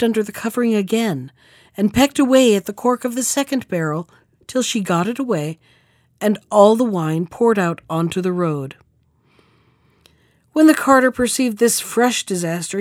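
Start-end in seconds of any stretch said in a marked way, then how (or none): none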